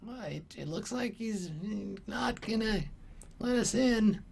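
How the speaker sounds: a little goblin admitting defeat under his breath; deflated goblin. Acted. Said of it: "and he's like".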